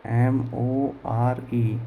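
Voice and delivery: very slow, letter by letter; each letter held apart; spelling out. Shown as a demonstration. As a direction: spell it out slowly for a class. Teacher spells the word out.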